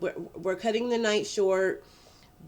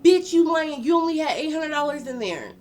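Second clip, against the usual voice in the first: a high, much louder outraged yell; Edwin yelling; 8 semitones higher, 7 dB louder than usual. Retold or imitated. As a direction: shout her down in outrage. Edwin shouts at her in line.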